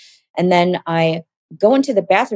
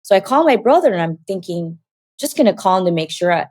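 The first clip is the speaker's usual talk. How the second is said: normal talking voice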